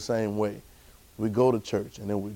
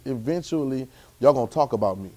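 normal talking voice

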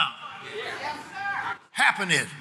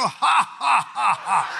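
strong, punched-out "ha, ha, ha"; deliberate laugh; 4 semitones higher, 3 dB louder, much slower than usual. Shown as a demonstration. Preacher urging the church to laugh, deliberate laugh. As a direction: declare each laugh like a word. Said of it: "Somebody help me laugh".

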